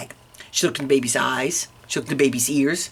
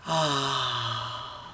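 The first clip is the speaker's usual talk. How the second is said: long soft falling "ah"; soothed baby sigh; 8 dB quieter than usual. Acted. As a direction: sigh as if settling to sleep. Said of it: "The baby said".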